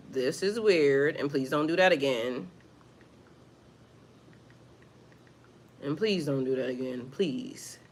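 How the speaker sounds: low and tired request to a rude commenter; quiet firm plea. Real, not acted. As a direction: ask them to stop, quietly.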